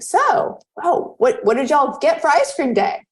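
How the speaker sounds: fake-casual, pry sweetly; mock-innocent high question to the headmaster; mock casual fishing.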